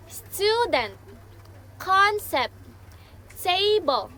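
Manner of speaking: "student, concept, table" each punched high on the first syllable; first-syllable stress. Shown as a demonstration. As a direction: hit STU-dent, CON-cept, TA-ble, one by one. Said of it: "stress in the first syllable".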